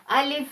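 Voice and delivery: अलिफ said quick and unstretched; short alif. Shown as a demonstration. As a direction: keep अलिफ brief, don't pull it. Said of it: "खींचेंगे नहीं".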